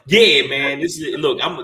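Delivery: normal talking voice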